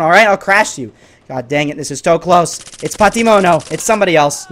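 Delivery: ordinary delivery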